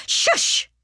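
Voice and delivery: unvoiced, sharp hush; mother's breath-only shush. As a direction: silence a cheeky child. Mother silences little sister.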